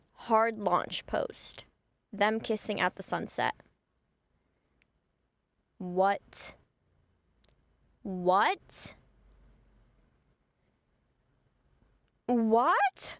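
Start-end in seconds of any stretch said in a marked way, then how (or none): 5.8-13.1 shocked disbelief. Retold or imitated. Seeing the hard launch post.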